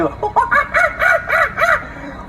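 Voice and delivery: yipping high coyote howl; coyote yips. Shown as a demonstration. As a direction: let out a high coyote yip. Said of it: "I'm gonna do a coyote right here".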